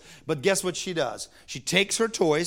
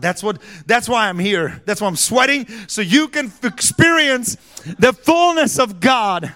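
louder, higher, building to a cry; preaching at full force; 6 semitones higher, 9 dB louder than usual. Acted. Preacher building to a climax for his congregation, loud. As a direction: drive it home to the crowd.

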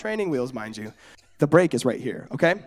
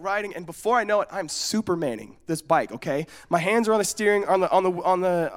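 usual speaking voice

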